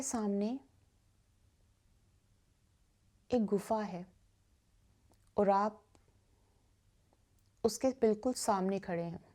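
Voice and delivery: guided meditation. Acted. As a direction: guide listeners gently into stillness, softening on repeated phrases. (धीमी, शांत ध्यान कराने वाली आवाज़)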